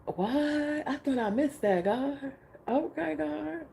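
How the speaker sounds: high, breathless amazement; awed disbelief. Retold or imitated. Answering God's gift, high amazed disbelief. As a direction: gasp it out in wonder.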